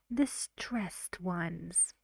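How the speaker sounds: normal talking voice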